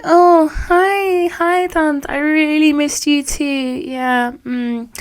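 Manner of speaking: high, sugary fake-polite hello; fake sweet greeting. Niece greeting the rude aunt, falsely sweet and high. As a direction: greet her with a forced sweetness. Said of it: "I'm like".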